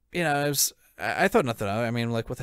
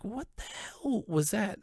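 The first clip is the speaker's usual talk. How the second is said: higher, slow, disbelieving; stunned; 9 semitones higher, 6 dB quieter, slower than usual. Retold or imitated. Thrown out of the venue, slow stunned question. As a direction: drag it out in disbelief.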